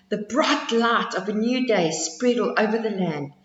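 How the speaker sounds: ordinary delivery, nothing marked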